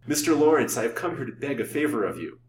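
agitated outburst, strongest on the opening words; wild outburst. A narrator's reading. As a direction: burst out with the plea. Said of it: "he broke out wildly".

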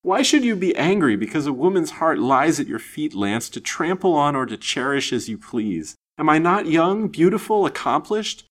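high, fervent pleading; eager torrent. A narrator's reading. Lady offering herself to the silent man, eager, wild pleading. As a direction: press the appeal with rising passion. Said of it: "the wild torrent of words she poured forth so eagerly".

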